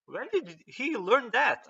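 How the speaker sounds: high, slow, stunned question; a master amazed at a pupil; shocked old master. Retold or imitated. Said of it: "even Roshi is like".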